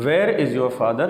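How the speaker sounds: slow Where as "wair"; correct where. Shown as a demonstration. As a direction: open "eh" vowel in Where. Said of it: "is the correct pronunciation".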